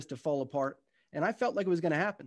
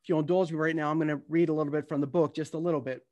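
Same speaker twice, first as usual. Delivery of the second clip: normal talking voice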